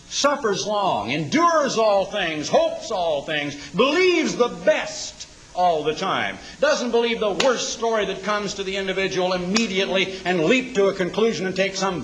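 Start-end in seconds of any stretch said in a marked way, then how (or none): 0.1-8.6 slow, measured recital of virtues; slow scripture recital. Acted. Preacher reciting First Corinthians to his flock, slow. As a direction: let each phrase land slowly.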